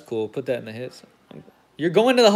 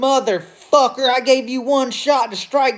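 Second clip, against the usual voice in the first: higher, put-on voice of a furious manager; angry manager voice; 6 semitones higher than usual. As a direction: play the manager who just saw his pitcher get rocked. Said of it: "he's like".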